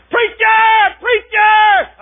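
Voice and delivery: loud, high calling out; urgent calling. Retold or imitated. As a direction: call the preacher across the church. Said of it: "He said".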